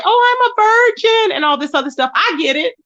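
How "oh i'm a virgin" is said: high, slow mock quote; high-pitched sing-song mockery of a claim; mocking imitation. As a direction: mimic his claim in a high prissy lilt. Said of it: "knowing that you say".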